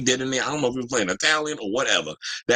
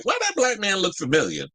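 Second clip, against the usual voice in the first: higher-pitched, mildly puzzled double take; puzzled reaction; 10 semitones higher than usual. Retold or imitated. Streamer retelling a celebrity sighting, puzzled. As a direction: voice the thought high, as if half recognizing someone.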